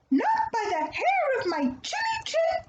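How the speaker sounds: high, defiant little pig. Acted. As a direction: squeak out a stubborn refusal. Pig refuses the wolf again.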